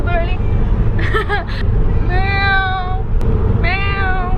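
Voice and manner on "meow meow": high playful cat meow; teasing little meow; mock meow. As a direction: meow at the dog like a kitten. Meowing at dog Burley.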